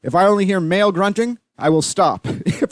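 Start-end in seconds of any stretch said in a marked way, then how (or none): none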